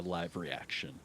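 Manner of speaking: ordinary talk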